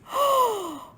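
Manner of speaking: a high, loud delighted gasp. Acted. Reader gasps at the page for the children. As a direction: gasp in wonder.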